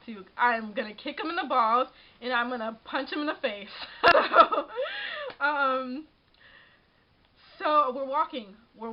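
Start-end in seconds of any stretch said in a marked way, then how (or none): 3.7-5.3 high squealing laugh; burst of laughter. Real, not acted. Laughing at her own threat to kick him, a squealing laugh. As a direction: break into a loud giggle.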